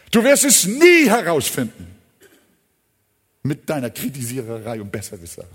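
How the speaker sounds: usual speaking voice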